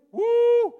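high, long, loud "woo"; loud exclaimed whoop. Real, not acted. Preacher to congregation at a prideful idea, loud high whoop. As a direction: let out a high whoop of disbelief. Reacting to thinking we unmake sacred.

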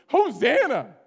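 loud, high, jubilant cry; screamed praise. Shown as a demonstration. Preacher crying out to his redeemer this morning, screamed praise. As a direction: scream it out to God. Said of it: "guess what I'm screaming this morning".

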